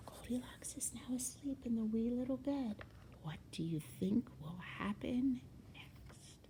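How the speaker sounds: soft whispered aside; hushed whisper. A narrator's reading. Narrator asks listeners what happens next, whispering. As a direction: whisper so the sleeper won't wake. Aside while Goldilocks sleeps.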